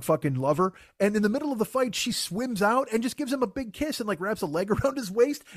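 ordinary talk, nothing marked

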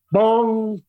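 high, ringing "bong"; sudden sung-out bong; imitated bell bong. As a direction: sound out a bell strike, drawn out. Imitates the idea striking him.

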